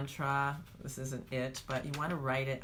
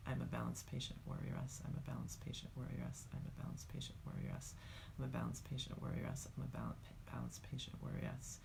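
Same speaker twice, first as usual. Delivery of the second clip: softer, faster mumbled repetition; mumbled mantra; 3 semitones lower, 10 dB quieter, faster than usual. Shown as a demonstration. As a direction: mumble it low under the breath. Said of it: "I'm going to mumble it".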